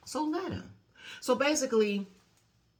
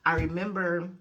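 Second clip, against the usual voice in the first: ordinary talk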